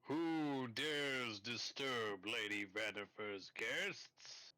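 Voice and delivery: slow, booming, echoing through the chamber. Acted. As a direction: boom it out like a magically amplified voice. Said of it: "you do hear a booming voice".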